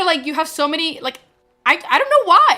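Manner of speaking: normal talking voice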